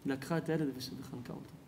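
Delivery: soft, sinking into near silence; hushed grief. Real, not acted. Preacher tells how the mother took her child, hushed grief. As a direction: let the last words drop away, hushed. Tragic climax of the story.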